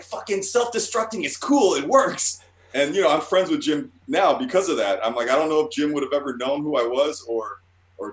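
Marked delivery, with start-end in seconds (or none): 0.0-2.4 high-pitched gleeful exclamation; triumphant elation. Retold or imitated. Self-saboteur who just won over his idol, triumphant. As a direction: crow over an unlikely victory. Triumphant realization after idol's approval.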